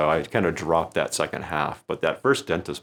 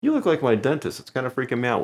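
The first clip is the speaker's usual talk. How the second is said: higher, teasing stage line; stage joke line; 7 semitones higher than usual. Shown as a demonstration. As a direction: comedian delivering the dentist joke to a volunteer, teasingly. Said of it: "I use that same line".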